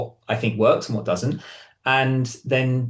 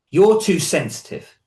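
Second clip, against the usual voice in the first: slow, louder, higher accusation; accusing; 6 semitones higher, 5 dB louder, slower than usual. Retold or imitated. Partner A blaming B, slow raised accusing tone. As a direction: partner A snapping at B, sharp accusation. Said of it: "I'm using different tones to get the voice".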